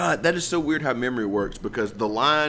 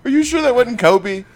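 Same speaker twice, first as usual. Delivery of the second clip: incredulous; 12 semitones higher, 5 dB louder than usual. Real, not acted. Teasing disbelief.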